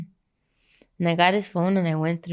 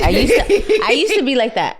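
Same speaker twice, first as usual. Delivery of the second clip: laughing; 7 semitones higher, 6 dB louder than usual. Real, not acted. Laughing confession.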